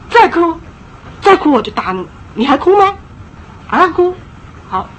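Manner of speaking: threatening scold. Retold or imitated. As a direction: warn the child you'll spank. Parent threatening crying child.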